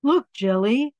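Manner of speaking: ordinary delivery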